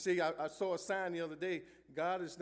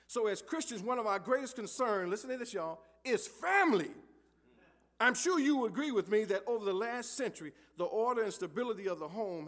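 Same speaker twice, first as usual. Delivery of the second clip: rising, louder preacher's call to the church; preaching; 3 semitones higher, 6 dB louder than usual. Acted. Pastor stirring up the congregation, preaching harder. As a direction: build it up, pressing the point to the pews.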